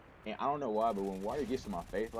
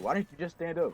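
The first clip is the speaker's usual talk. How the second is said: incredulous question; 6 semitones higher, 4 dB louder than usual. Retold or imitated. Friends asking in disbelief.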